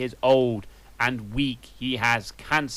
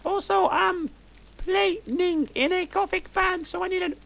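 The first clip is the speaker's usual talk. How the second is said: put-on squeaky high goth girl, breathless gush; shrill up in the head like a teen diva; high valley girl voice. Narrator gushing to friends in a high valley girl voice.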